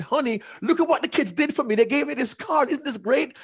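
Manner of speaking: very high, fast, gushing excitement; high excited chatter to his wife; thrilled. Retold or imitated. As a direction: bubble over like a giddy husband. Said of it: "I was so thrilled".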